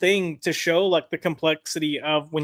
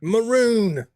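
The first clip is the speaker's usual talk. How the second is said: playful cartoon-style put-down; hammed-up mock contempt; mocking insult; 4 semitones higher, 3 dB louder than usual. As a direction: jeer it like a cartoon catchphrase. Mocking the writer with cartoon catchphrase.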